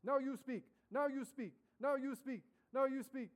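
high, loud, chanted broken English commands. Retold or imitated. Missionaries pushing her to speak in tongues, loud broken English. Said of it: "in broken English".

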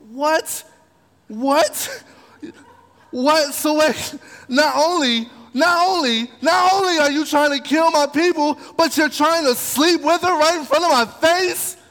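high, loud outraged accusation; furious king. Retold or imitated. King catching Haman on the queen's sofa, loud and outraged. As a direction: roar your disbelief at the traitor.

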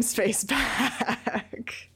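laughing through the words. Real, not acted. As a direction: breaks into a laugh mid-sentence. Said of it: "I started laughing in the middle".

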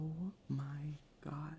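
shocked breathless whisper. Real, not acted. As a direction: gasp it out under your breath. Shocked reaction to daily calls.

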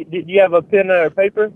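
ordinary talk

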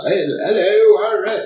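high, loud hammed-up British greeting; mock British accent. Imitating roommate Chuck's put-on British accent, high and loud. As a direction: lampoon a friend's fake British accent. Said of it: "trying to speak with a British accent".

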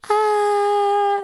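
long, high, held stalling noise; reluctant "ahh". Retold or imitated. Comedian answering his offer to be on that show, high reluctant stalling. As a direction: draw out a high "ahh" to dodge saying no. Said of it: "Okay, that's a no".

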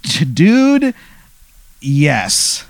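high, bursting "dude, yes"; excited yes. Acted. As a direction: blurt it out, can't contain the joy. Excited NPC answering player's question.

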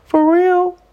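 high-pitched, stunned "for real"; retelling her own shocked reaction; shocked disbelief. Retold or imitated. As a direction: voice jumps up in disbelief. Shocked reply to psychic.

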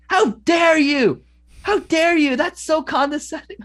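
high-pitched, affronted imitation; mock indignation. Imitating an offended friend in an argument, indignant.

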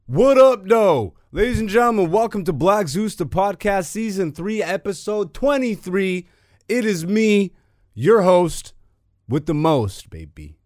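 loud, high hyped-up announcer welcome; hype host intro. Acted. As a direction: open the show like a big announcer.

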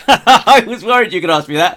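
laughing while admitting it; said through a real laugh. Real, not acted. Caught out by a question.

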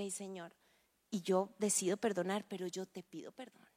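soft prayer. Shown as a demonstration. Preacher models a humble prayer, hushed. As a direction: confess quietly to God.

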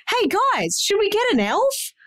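mock the husband's keen offer; imitating husband. Wife imitates husband Nick.

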